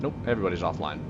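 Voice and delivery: ordinary talk, nothing marked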